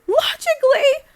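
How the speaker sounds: LOGICALLY thrown back loud and very high; outraged echo of LOGICALLY. Acted. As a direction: shriek "Logically?" in disbelief. Mother throws the word back.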